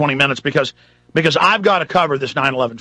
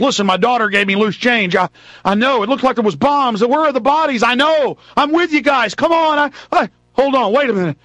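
mock the detective as he gets more and more worked up; excited detective; 7 semitones higher than usual. Detective agreeing with the reporters, getting more and more excited. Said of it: "he comes up and he says".

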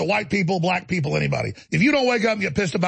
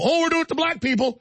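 higher-pitched mocking imitation of those caught; mock confession; 9 semitones higher than usual. Caught schemers owning up to the public, mock confession. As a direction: put on a higher mock-innocent quote. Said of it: "they go".

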